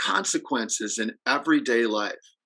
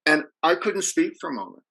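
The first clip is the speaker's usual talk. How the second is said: normal talking voice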